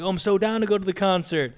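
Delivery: mock thrilled yes to the concert; hammed-up excitement. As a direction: play it over the top, thrilled to be invited. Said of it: "I'm like".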